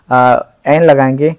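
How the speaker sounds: ordinary talk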